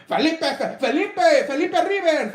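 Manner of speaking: high-pitched imitation, cracking up on the name; uncle's nickname voice. Host cracking up at his uncle's name for Philip, mock voice. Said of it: "as my uncle calls him".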